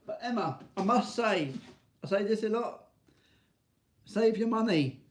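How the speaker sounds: mock scolding. Real, not acted. Scolding friend Emma for buying gifts, playful telling-off. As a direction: tease her for wasting money.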